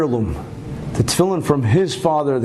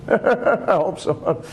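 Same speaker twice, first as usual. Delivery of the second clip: words through a chuckle; real laughing; 4 semitones higher, much slower than usual. Real, not acted. Joking about his ancestor's angry wife, laughing. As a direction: laugh as you say it, slowly.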